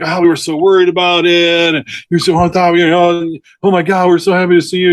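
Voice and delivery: high, fast mock gushing; hunter's buddies thanking the rangers, high gushing mock voice. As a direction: ham up the worried friends' relief. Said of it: "his buddies are like".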